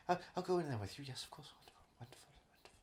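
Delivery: very soft, fading into a whisper; mock dying fade. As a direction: let the words drift away as if dying. Acted death fading into the afterlife.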